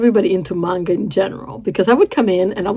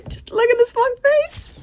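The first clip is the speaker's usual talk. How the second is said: very high, stretched-out squeak; giddy squeal; slower than usual. Real, not acted. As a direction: squeak it slowly, overcome with delight. Gushing at the same face again.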